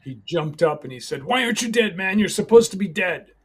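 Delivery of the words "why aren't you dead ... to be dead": shooter yelling in disbelief, high and loud; retold shout. Retelling the gunman's shock, a loud high yell. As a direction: cry it out, much higher and louder.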